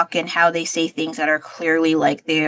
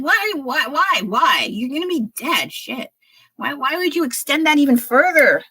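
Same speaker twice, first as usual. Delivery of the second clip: exasperated rant; 8 semitones higher, 3 dB louder than usual. Real, not acted. Ranting about retirement age.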